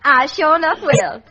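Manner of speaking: hammed-up Southern belle accent. Secretary answering the studio boss, in a Southern accent. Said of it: "a girl who talks like a Southerner".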